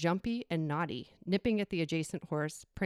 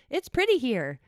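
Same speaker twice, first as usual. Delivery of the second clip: put-on child voice; 7 semitones higher, 6 dB louder than usual. Kid remarking at entrance.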